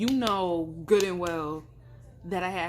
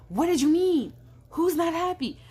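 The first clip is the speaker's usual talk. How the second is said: high, loud, alarmed questioning; mock urgent outcry, pressing for answers; indignant demand; 10 semitones higher, 6 dB louder than usual. Friend texting her for an explanation, loud indignant demand.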